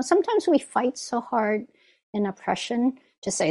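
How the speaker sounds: usual speaking voice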